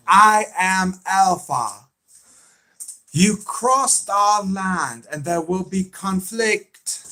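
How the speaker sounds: high, drawn-out villain impression; Alpha. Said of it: "That's the way she sounds".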